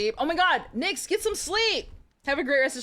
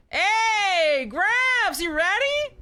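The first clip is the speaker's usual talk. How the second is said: put-on much higher, louder eager kid voice; excited young hero hailing grandpa; calling out; 12 semitones higher, 8 dB louder, much slower than usual. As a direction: shout it up to Gramps like an excited boy.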